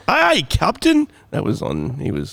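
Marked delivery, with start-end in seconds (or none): 0.0-1.1 put-on high, loud comic Scottish accent; exaggerated Scottish sailor's reply. Pastor who always wanted to be Scottish, Scottish accent. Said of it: "I always wanted to be Scottish".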